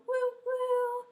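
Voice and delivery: high-pitched imitated train whistle; high "woo" of a toy train. Shown as a demonstration. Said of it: "Our whistle is gonna go".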